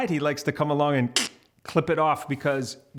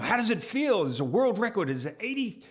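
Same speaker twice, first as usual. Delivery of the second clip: excited interviewer; 8 semitones higher than usual. Retold or imitated. Interviewer quizzing rugby player.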